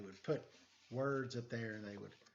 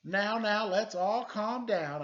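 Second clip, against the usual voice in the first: louder, slow soothing call; calming; 6 semitones higher, 8 dB louder than usual. Acted. Detective calming the shouting crowd, soothing. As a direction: settle the crowd down. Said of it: "I said".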